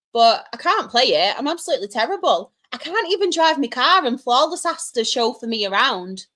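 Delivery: ordinary talk, nothing marked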